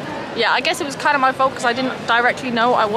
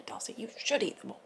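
ordinary talk

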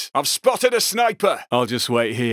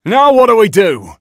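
loud exasperated question; 8 dB louder than usual. Acted. Game heister exclaiming mid-heist.

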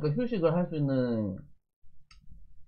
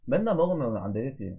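speaking between bites of food; talking while chewing; 2 semitones lower than usual. Real, not acted. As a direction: mouth partly full of food. Seen 1: Mouth works food mid-bite; chopsticks and rice bowl in hand.

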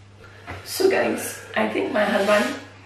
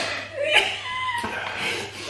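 high laugh; 5 dB quieter than usual. Real, not acted. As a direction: burst of high giggling. Laughing at crying husband.

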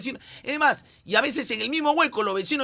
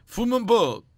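announcer voice; slower than usual. Acted. Dramatic superhero intro announcement.

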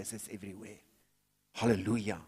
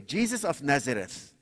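ordinary talk

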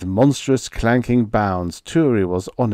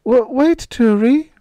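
ordinary delivery

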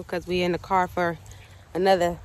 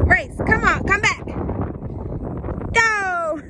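high, raised-voice call to a dog; 10 semitones higher, slower than usual. Real, not acted. Racing the dog back, high excited calling. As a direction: call out excitedly across a park.

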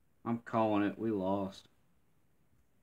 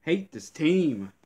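higher, drawn-out hate; slow, higher grumbling hatred; slow seething; 5 semitones higher, 5 dB louder, slower than usual. Real, not acted. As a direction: stretch each word out in disgust. Fuming at own team.

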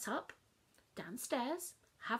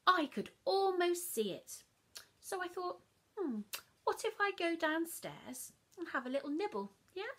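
put-on higher, louder, excited child; bubbling with a naughty idea; eager temptation; 7 semitones higher, 4 dB louder than usual. Child plotting a midnight nibble, excited and higher.

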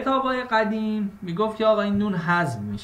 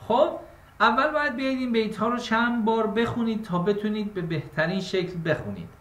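usual speaking voice

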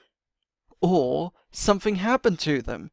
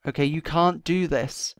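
usual speaking voice

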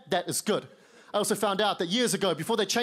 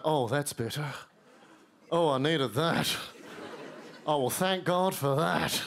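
relieved sigh; 5 semitones lower, slower than usual. Retold or imitated. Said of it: "British people are always like".

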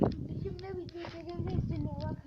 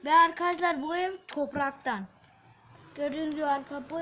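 loud; 11 dB louder than usual. Real, not acted. Loud dramatic presenting.

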